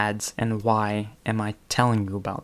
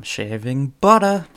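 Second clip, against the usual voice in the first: louder, higher mock Boston accent on "butter"; 4 semitones higher, 7 dB louder than usual. Comedian selling shaving butter, hammed-up Boston accent. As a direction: exaggerate a Boston "butter", dropping the r. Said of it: "in a Boston accent".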